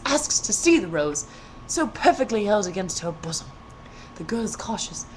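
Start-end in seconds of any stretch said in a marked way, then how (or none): none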